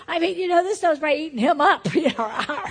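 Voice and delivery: talking through laughter; laughing. Real, not acted. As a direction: laugh it out loud.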